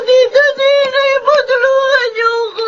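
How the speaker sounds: loud, slow desperate plea; pleading cry. Acted. Minet begging Tito Ninoy for help, desperate. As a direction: beg your uncle to save you.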